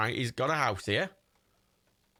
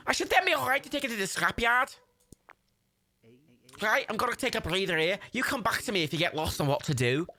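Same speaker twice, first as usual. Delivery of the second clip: put-on Scouse accent, pitched up; Scouse Jimmy; 7 semitones higher than usual. Scrapper leaving helper to it, put-on Scouse accent. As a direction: hand over the task in high Scouse. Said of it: "Thanks, Garage Jimmy".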